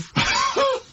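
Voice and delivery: high shrieking burst of laughter; big laugh. Real, not acted. Hearing Carl's gift story.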